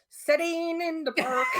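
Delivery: slow, sung line in a bad Irish accent; badly faked Irish lilt, drawn out. Singing on request for a donor, bad Irish accent. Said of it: "it's gonna be bad".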